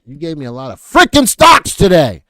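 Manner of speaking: loud, mock-overwhelmed yell; comic outburst of frustration; exasperated shout. Real, not acted. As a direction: blow up at the chat for all those stocks. Overwhelmed by viewers' stock list.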